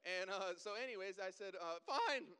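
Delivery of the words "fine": loud, very high panicked yelp; squeaky nervous voice. Retold or imitated. Nervous driver answering the trooper, squeaky. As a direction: voice shoots up out of control. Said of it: "Your voice gets, like, way squeakier".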